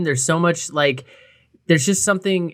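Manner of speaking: ordinary delivery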